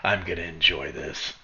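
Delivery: low, softened chuckle through the words; lightly acted menacing laugh. Headbutted guard laughing at his captive, mildly menacing relish. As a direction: let a dark chuckle lead into relish. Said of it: "and he laughs".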